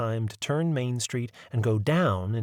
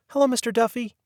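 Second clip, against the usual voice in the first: put-on cheerful boy; 12 semitones higher, 3 dB louder, faster than usual. A narrator's reading. Boy greeting conductor.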